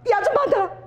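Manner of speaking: pushy shout. Retold or imitated. Weeping woman shoving past a passenger, frantic high yell. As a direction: shriek at them to move aside. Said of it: "그러고 막 그 사람 손을 막 잡아 빼는 거야".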